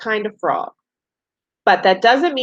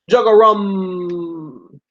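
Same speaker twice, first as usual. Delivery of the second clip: bullfrog call; much slower than usual. Retold or imitated. Teacher reading to kids, croaking slowly like a bullfrog. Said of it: "A bullfrog says".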